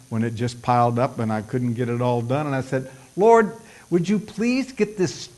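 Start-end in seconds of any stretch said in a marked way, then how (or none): 3.2-5.3 high-pitched, put-upon complaint to God; exasperated plea. Retold or imitated. Preacher retelling his prayer over a stubborn computer, comically exasperated. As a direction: plead in mock exasperation, voice pushed up. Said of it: "I said it in that tone of voice".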